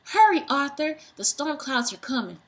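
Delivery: high, loud urgent call; urgent yell. A narrator's reading. Bo yelling to Arthur as storm nears, urgent. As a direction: shout him to hurry.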